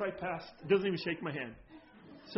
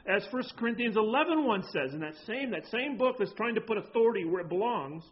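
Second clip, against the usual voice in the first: louder, driving exhortation; preaching; 5 semitones higher, 5 dB louder than usual. Acted. Pastor charging the congregation to follow, preaching. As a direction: build the charge to follow him.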